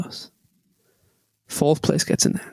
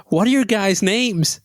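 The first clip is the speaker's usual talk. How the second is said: slower, higher mock American accent; 4 semitones higher, slower than usual. American newcomer asks the team's names, put-on American accent. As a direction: draw out the question in imitated American. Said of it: "this American guy comes up to us".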